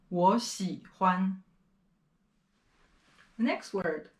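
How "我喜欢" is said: 我 in 我喜欢 rising as a second tone; 我喜欢 slowly, 我 rising; sandhi second tone. Shown as a demonstration. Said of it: "just a special case".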